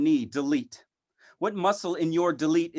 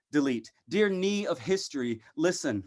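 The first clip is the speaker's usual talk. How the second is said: ordinary talk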